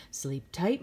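ordinary delivery, nothing marked

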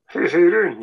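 high, loud put-on greeting voice; silly voice. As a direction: greet them in a daft cartoon voice. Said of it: "silly voices".

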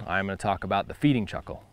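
usual speaking voice

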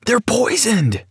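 high, slow panicked scream. Acted. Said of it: "I screamed back".

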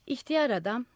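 ordinary delivery, nothing marked

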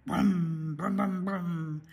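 low, humming car motor; throaty hummed vroom noises; imitated engine revving. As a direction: rev it like an old car.

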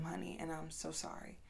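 very soft, low, near-whispered consolation; tender apology. Real, not acted. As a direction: let the voice drop away gently. Consoling victims, voice drops away.

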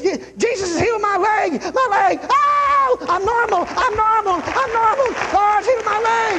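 very high, overjoyed hollering; imitated high-pitched shouts of amazement, repeated breathlessly; re-enacted ecstatic shouting. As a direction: cry out like a man who was just healed. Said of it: "He says".

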